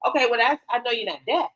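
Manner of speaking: normal talking voice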